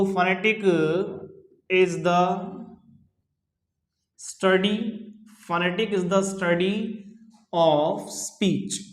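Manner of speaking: slow, broken into short chunks; dictation. Real, not acted. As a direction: dictate it piece by piece with long pauses. Teacher dictating a definition. (धीरे-धीरे रुक-रुककर लिखवाते हुए)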